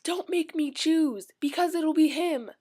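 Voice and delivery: high, loud swooning plea; slowed, pleading Bella impression; mock Bella. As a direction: ham up a lovesick Bella. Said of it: "Then she goes".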